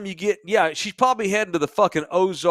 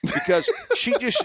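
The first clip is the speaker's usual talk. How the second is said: laughing. Real, not acted.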